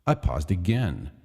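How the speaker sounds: usual speaking voice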